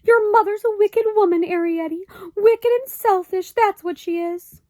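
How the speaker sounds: high, loud, upset outburst; a mother near tears, railing; quivering high indignation; trembling voice. A narrator's reading. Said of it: "Homley's voice began to tremble".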